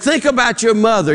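high-pitched, urgent mock plea; mock-desperate pleading. Counselor to jumper, mock-desperate pleading. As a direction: grasp for a reason, pitched high. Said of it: "he's really desperate".

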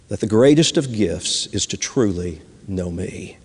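put-on God kneeling close, speaking tenderly to his child. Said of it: "and tenderly said".

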